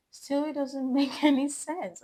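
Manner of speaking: laughing. Real, not acted. Laughs at own confusion.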